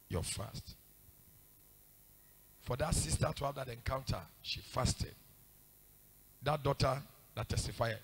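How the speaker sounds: soft, low-pitched pleading; hushed pleading exhortation. Real, not acted. Preacher begging the congregation to keep fasting, hushed. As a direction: beg them quietly and earnestly.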